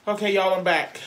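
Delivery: forced cheerful. Real, not acted. Forced cheer despite pain.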